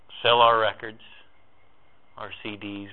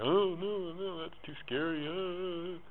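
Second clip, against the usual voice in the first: mock scared mind voice; 7 semitones higher, 9 dB quieter, slower than usual. Fearful mind voiced in talk.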